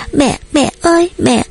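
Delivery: put-on very high small-child call; toddler copying sister. A narrator's reading. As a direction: echo big sister's cry for mummy. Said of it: "rồi bắt chước".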